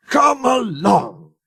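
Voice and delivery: slow, high calling out; an engine hurrying its train; puffing call. A narrator's reading. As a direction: urge the coaches along, chugging. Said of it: "he puffed".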